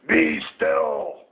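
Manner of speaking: low, growly command; commanding Max. A narrator's reading. Max commanding the wild things, low firm order. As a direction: order the monsters low and firmly. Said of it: "till Max said".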